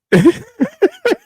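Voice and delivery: sudden loud high burst of laughter. Real, not acted. Reacting to the crying lady, a loud high laugh. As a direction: burst out laughing at her face.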